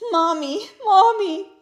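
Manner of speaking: a stuck bunny wailing for mommy; crying out for mommy. Acted. Rabbit stuck in prickles, crying high for mommy. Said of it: "he cried".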